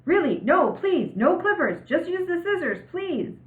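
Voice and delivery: high, loud pleading yell; boy yelling at the barber to stop cutting. Acted. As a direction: shout every instruction at the barber. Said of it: "practically yelled at the man the whole time".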